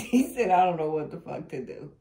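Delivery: loud retelling through laughter; cracking up. Real, not acted. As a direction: blurt the quote while laughing. Repeats quote while cracking up.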